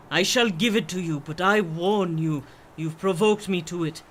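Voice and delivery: ordinary talk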